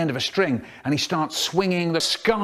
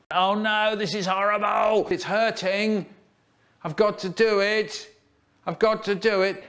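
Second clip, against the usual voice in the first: high, panicky wail; agonised wailing; 6 semitones higher than usual. A narrator's reading. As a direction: wail as if helplessly compelled. Acted agony, compelled to obey.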